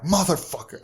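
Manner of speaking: high-pitched retold curse of anger. Told he will miss his flight, retold anger. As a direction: curse in frustration, pitch jumping up. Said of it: "I'm fucking angry".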